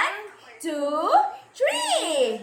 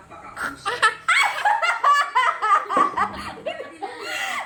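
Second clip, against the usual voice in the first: high squealing laugh while tickling; being tickled; 7 semitones higher than usual. Real, not acted. Kids being tickled, level two.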